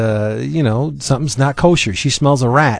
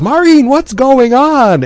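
high, louder, slow worried call; alarmed editor; 12 semitones higher, 5 dB louder than usual. Retold or imitated. Editor on the cell phone hearing the break-in, high and slow. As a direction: play the panicked boss on the phone. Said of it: "Bert Hall goes".

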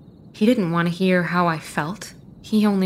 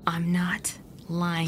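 slow terrified whisper; frightened whisper; 3 semitones lower, 8 dB quieter, slower than usual. Acted. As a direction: barely breathe the denial. Georgia denies, terrified, hushed.

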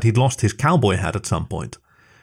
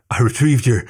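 gasping. A narrator's reading. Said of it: "he gasped".

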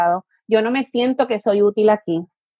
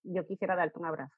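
tender reply; 5 semitones lower, 15 dB quieter, faster than usual. Acted. Parent answering the refusing child.

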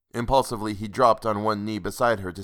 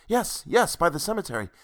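eager; 7 semitones higher than usual. A narrator's reading. He answers eagerly.

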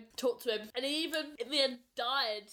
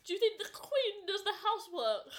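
Mary Poppins; 8 semitones higher than usual. Acted. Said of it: "and she says".

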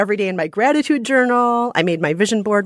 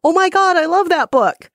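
much higher, louder gushing "oh my God"; gushing fan; 11 semitones higher, 4 dB louder than usual. Retold or imitated. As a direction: imitate an overexcited stranger. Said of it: "they would actually come up to me and say".

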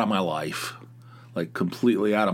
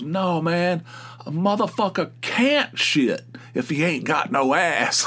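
higher-pitched exasperated outburst; 7 semitones higher than usual. Retold or imitated. Coworker objecting to an absurd tabloid debate, exasperated. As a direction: imitate a fed-up coworker protesting emphatically. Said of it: "he got all exasperated".

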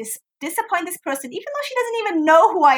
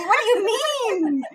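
disbelieving; 8 semitones higher, 4 dB quieter than usual. Retold or imitated. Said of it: "Shazia could not believe".

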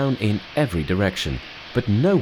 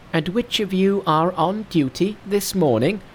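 high, bright-pitched monkey king; 11 semitones higher than usual. Acted. Narrator voicing Monkey to his master, high monkey voice. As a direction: play the cocky monkey hero up high.

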